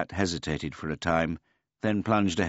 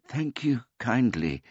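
put-on high, polite old man voice; a gentle, childlike old man; simple old man; 8 semitones higher, 3 dB louder than usual. A narrator's reading.

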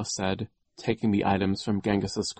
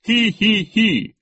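high, loud villain giggle; spelt-out "hee hee hee"; gleeful snicker; 11 dB louder, slower than usual. A narrator's reading. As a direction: snicker with nasty delight. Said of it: "grinning in delight".

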